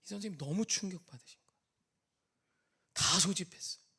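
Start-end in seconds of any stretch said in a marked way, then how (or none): none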